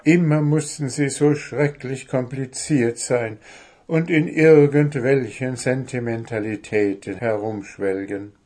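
usual speaking voice

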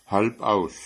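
short, offhand answer from the cat; a touch of a curt cat; cat's curt answer. Acted. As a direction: toss it off as if nothing. Cat names the child.

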